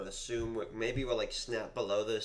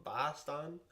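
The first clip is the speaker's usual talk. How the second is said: slow, high "Boston" as "BAH-stahn"; puzzled try at a Boston accent, stretched out; exaggerated Boston accent, rising in question; mock Boston accent; 6 semitones higher, much slower than usual. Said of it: "the way people say Boston".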